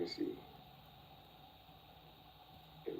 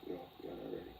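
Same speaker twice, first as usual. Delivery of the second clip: No, y'all not ready said lower and softer; lower, softer repeat; 8 semitones lower, 8 dB quieter, much faster than usual. Retold or imitated. Repeating her quote to the boys, lower and softer.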